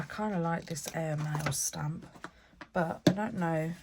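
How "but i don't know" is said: usual speaking voice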